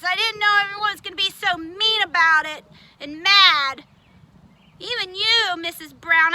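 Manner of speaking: usual speaking voice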